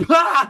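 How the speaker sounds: high, loud scoffing "pah" with a laugh; scoffing burst. Retold or imitated. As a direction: spit out a mocking laugh of disbelief. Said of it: "Like, out loud".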